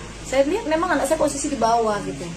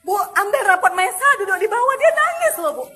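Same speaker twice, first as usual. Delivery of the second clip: high, loud, near tears while pleading; a mother close to crying over her son; tearful, upset; 6 semitones higher, 4 dB louder than usual. Real, not acted. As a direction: voice strained and rising with distress. Mother upset recounting child's punishment.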